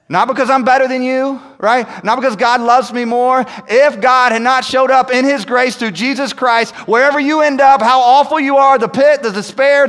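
loud, high, building preaching; full-force preaching. Acted. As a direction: preach it out to the whole church, climbing.

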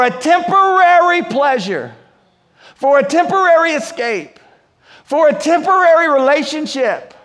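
high, forceful sermon climax; fervent preacher in full flow; impassioned preaching. Real, not acted. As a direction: drive each repeated phrase home to the congregation.

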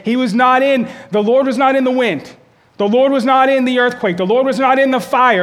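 not punched high and loud each time; The Lord was not in the fire, with not driven hard; stress on not. Shown as a demonstration. Preacher hammering where God was not.

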